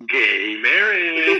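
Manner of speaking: high, long drawn-out mock scream. Retelling the chainsaw Dean's entrance, drawn-out mock scream. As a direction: stretch it out like a hammed-up horror scream. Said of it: "just screams".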